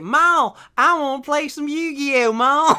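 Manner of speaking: high, loud Southern kid impression; Southern accent. Teasing friend as a young Southerner begging Mom, mock Southern accent. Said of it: "a Southern accent".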